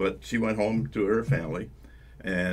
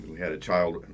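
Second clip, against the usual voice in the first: ordinary talk, nothing marked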